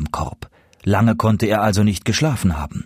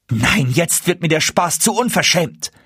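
high-pitched, outraged exclamation; indignant calling out; 8 semitones higher than usual. A narrator's reading. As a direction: call over to a neighbour in indignation. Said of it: "rief Hanne ihrer Nachbarin zu". (empört und hoch gerufen)

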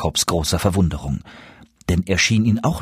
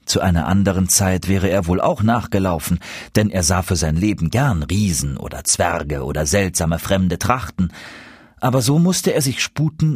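ordinary delivery, nothing marked

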